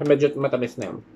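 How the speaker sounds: lips sealed on straw; speaking mid-sip; talking around a straw. Real, not acted. Seen 1: Straw between lips 0.3-2.4; later only beside mouth while talking.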